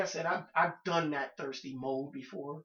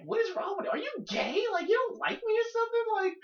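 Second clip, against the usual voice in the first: much higher, mocking offended girl's voice; mock angry woman; 5 dB louder than usual. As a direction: play the rejected woman, high and indignant. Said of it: "she's getting madder and madder".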